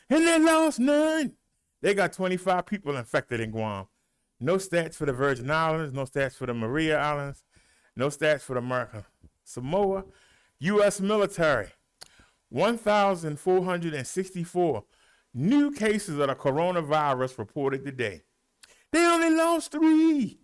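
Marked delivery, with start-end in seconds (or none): 0.1-1.3 high, drawn-out exclamation; celebratory cry. Real, not acted. Celebrating no deaths reported. 18.9-20.4 high, loud, stretched-out call; excited high-pitched announcement; celebratory cry. Real, not acted. Celebrating a low death count.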